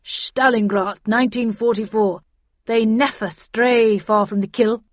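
put-on loud, emphatic old-campaigner delivery; commander's voice. A narrator's reading. Commander recalling past hunts to the team, loud and forceful. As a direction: declare it forcefully.

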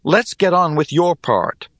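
tense, grim insistence. A narrator's reading. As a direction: push them on grimly. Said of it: "I said grimly".

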